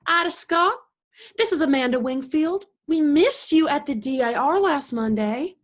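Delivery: high, effusive hello to a subscriber; telephone greeting. Acted. Acted sales call on telephone.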